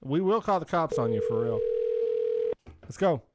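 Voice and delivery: normal talking voice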